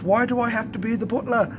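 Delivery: high-pitched mock English accent. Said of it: "hear him in his accent".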